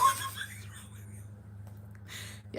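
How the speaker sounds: high, squeaky laughing through the words; can barely get it out for laughing; real laughter, voice cracking up high. Real, not acted.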